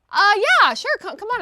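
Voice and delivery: high, over-eager mock yes; awkward acted agreement. Tenant reluctantly inviting landlord to her party, awkward high agreement. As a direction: blurt a loud 'uh' into a high-pitched yes.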